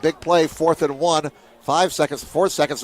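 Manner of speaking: usual speaking voice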